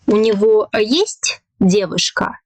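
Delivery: sharp rise on "есть", then drop; rising question intonation. Shown as a demonstration. Teacher demonstrating a Russian question to learners, pitch jumping up on "есть". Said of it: "here we have a rising intonation".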